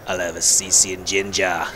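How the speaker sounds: throaty Boston accent ordering a drink. Shown as a demonstration. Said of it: "throaty Boston accent".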